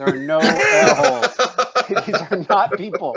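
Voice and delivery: loud, high, laughing insistence; exasperated and cracking up; laughing protest. Real, not acted. Game master protests through table's laughter.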